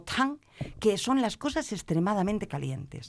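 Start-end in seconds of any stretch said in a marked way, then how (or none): 0.0-0.4 "tang" starting high and falling; fourth tone TANG. Shown as a demonstration. Student gives the last diet rule, "tang" falling. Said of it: "Y por último".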